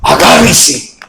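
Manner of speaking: ordinary talk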